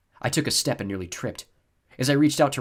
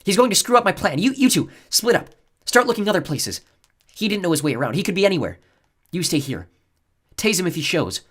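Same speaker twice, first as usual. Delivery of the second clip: louder, higher, impatient orders; 10 semitones higher, 9 dB louder than usual. A narrator's reading. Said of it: "Sounding impatient".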